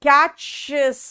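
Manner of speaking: कैचेस drawn out slowly as one word; wrong plural catches. Shown as a demonstration. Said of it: "नहीं कर पाओगे". (गलत कैचेस, धीरे खींचकर)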